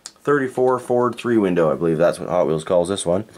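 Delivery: ordinary talk, nothing marked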